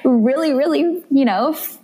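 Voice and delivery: high-pitched, gushing plea; playful re-enactment of giddy excitement; retold excitement. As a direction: plead your case in an excited high voice. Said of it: "I just get so excited about the little things".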